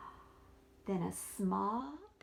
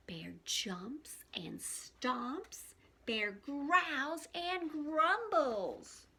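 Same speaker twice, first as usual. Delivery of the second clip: slow, rumbling, building read of the bear waking; dramatic story narration; 5 dB louder, much slower than usual. A narrator's reading. Teacher reading the angry bear waking to children, slow and growly. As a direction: drag out each verb, growling bigger.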